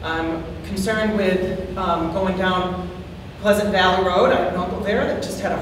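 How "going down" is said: normal talking voice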